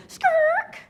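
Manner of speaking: drawn-out screeching noise; imitated tire screech. Storyteller mimics the police SUV pulling up, screech. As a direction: imitate a screeching police car, long and loud.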